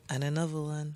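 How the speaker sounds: lightly voiced [name] catchphrase; half-hearted [name] impression. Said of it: "supposed to be my [name] voice".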